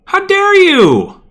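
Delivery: high, slow, loud outraged shout; mock outrage. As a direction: shriek it in theatrical outrage. Outraged outburst at game.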